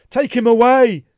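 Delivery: high, very loud desperate cry; frantic high-pitched yelling; panicked yell. A narrator's reading. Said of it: "he yelled".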